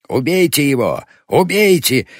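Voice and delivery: high, loud, furious command; raging shout. A narrator's reading. New leader rouses the crowd to kill, enraged shouting. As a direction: scream for blood, rising on the repeat. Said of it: "бился в злобе новый вожак".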